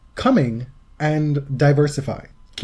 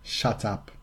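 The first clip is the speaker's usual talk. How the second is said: soft, teasing attempt at a Murray-style accent; Andy Murray impression; 3 semitones lower, 9 dB quieter than usual. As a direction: quietly mimic the Scottish player's accent. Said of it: "you just started dropping your T's".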